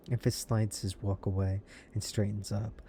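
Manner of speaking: ordinary talk, nothing marked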